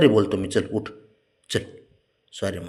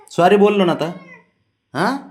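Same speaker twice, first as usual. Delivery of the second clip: louder, higher pleading apology; pleading sorry; 10 semitones higher, 7 dB louder than usual. Real, not acted. Owner begging his sulking dog, loud pleading sorry. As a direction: raise your voice to beg forgiveness.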